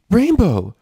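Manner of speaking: high loud call; alarmed cry. A narrator's reading. Twilight finding Rainbow sick, alarmed.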